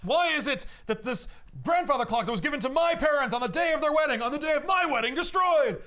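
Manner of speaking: high, loud, building indignant tirade; outraged rant. Retold or imitated. Groom demands why heirloom clock broke, mock outrage. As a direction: climb to a shouted, incredulous finish. Bruce rants over destroyed family clock.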